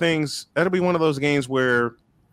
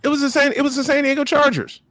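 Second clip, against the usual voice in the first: insistent, higher; emphatic; 12 semitones higher than usual. Real, not acted. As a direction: hammer the team name home. Emphatic repeat of team.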